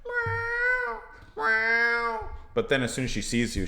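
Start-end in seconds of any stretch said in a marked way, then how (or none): 0.1-2.3 high, drawn-out meow like a crying cat. Retold or imitated. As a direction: mimic a lonely cat calling out. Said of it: "she starts walking around going".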